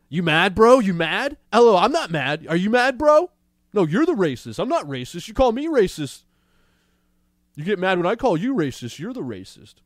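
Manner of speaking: high, loud taunting you mad bro; mocking bro voice. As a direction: taunt like a smug internet troll. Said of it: "mocking the host's attitude".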